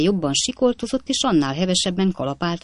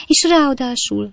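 higher, louder theatrical sobbing; 8 semitones higher, 4 dB louder than usual. A narrator's reading. Wife sobbing to her maid about her husband, theatrical. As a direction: wail it through sobs. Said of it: "zokogott Potné".

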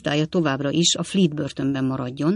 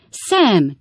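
louder call after someone leaving; 4 semitones higher, 6 dB louder than usual. A narrator's reading. Master calling after servant rushing out, loud call. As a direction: call him back across the room. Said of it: "kiáltott utána Pickwick úr".

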